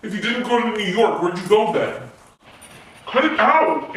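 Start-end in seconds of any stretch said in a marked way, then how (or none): 3.1-3.9 higher, sharp demand to stop; irritated protest. Acted. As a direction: tell him off with raised pitch. Irritated roommate during tense argument.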